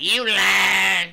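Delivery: loud, accusing "you lying"; accusing shout. Retold or imitated. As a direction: call them out loudly. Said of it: "people be like".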